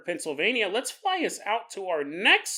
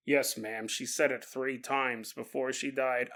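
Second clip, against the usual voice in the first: low reply; 8 semitones lower, 9 dB quieter than usual. Acted. Low reply to old lady.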